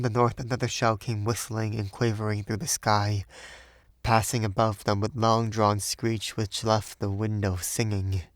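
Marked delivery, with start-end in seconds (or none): none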